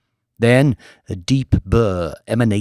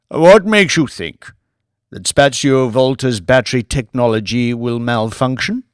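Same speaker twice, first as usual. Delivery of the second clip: put-on a grand old lord, lofty and measured; 5 dB louder than usual. Lord Martin's voice in story.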